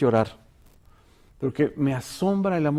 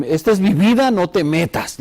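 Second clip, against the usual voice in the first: defiant loud retort; 4 semitones higher, 9 dB louder than usual. Retold or imitated. Voicing people who shut others out.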